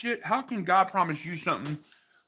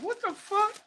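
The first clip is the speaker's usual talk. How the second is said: incredulous; 4 dB quieter than usual. Retold or imitated. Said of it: "I'm like".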